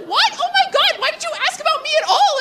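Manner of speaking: very high, fast disbelief; shocked squeal. Retold or imitated. Comic hearing best friend got engaged, shocked squeal. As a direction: shriek it in shock, laughing.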